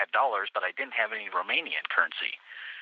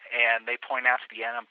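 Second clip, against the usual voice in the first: ordinary delivery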